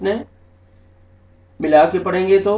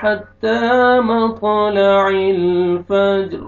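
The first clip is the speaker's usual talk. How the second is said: slow, high, louder melodic tilawat; Quran recitation; 3 semitones higher, 5 dB louder, much slower than usual. Shown as a demonstration. Teacher demonstrating where to stop, in slow melodic recitation. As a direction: chant it, pausing after امر. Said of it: "اگر آپ یہاں پہ stop کرتے ہیں".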